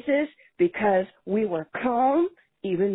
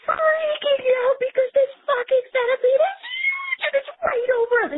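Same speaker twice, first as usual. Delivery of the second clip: high, louder panicked near-shout; retold panic about a huge centipede overhead; freaking out; 4 dB louder than usual. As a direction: let the panic burst out. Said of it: "express the fact that we are freaking out".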